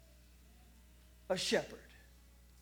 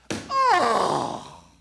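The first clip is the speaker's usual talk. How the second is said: whiny groan; 4 semitones higher, 11 dB louder than usual. Acted. Child protesting bedtime.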